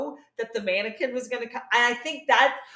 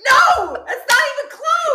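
high, loud mock yell through laughter; laughing yell; 13 dB louder than usual. Teacher scolding Joey's French, laughing mock shout. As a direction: yell the correction while cracking up. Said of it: "she yells at him".

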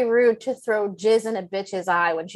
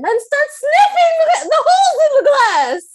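high and loud, breaking into laughter; cracking up while telling it; shrieking with laughter at the creepy bit; laughing loud; 10 dB louder than usual. Real, not acted. Laughing retelling creepy sniffing.

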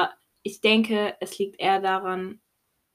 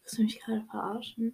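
incredulous whisper; 2 semitones higher, 6 dB quieter than usual. Real, not acted. Disbelief at silly riddle.

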